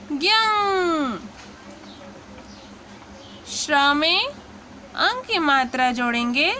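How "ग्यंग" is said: ग्यंग stretched slowly, nasal ending held; drawn-out syllable. Shown as a demonstration. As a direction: sound out ग्यंग slowly for the class. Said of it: "उच्चारण होगा".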